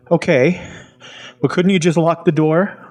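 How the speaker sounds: concede, then object in a high pleading pitch; protesting concession. Acted. Restrained man argues with his captor.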